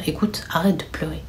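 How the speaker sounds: urgent soft whisper; whispered comfort. Retold or imitated. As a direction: whisper to calm her down. Older sister hushing crying sister.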